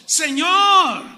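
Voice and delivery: high, loud cry for help; alarmed shout. Retold or imitated. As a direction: call out to the sleeping master in panic. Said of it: "los signos de admiración allí, que era algo, pues, subido de volumen de voz". (grito asustado)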